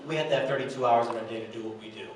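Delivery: usual speaking voice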